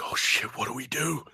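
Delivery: hushed, unvoiced aside; panicked whisper. Recruit to bunkmates after the box comes on, urgent whisper. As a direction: whisper it to your buddies, caught out. Caught out, asking the others secretly.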